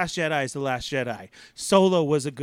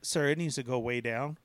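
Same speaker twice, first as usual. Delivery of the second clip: ride attendant; 5 semitones lower, 7 dB quieter than usual. Retold or imitated. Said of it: "And the guy says".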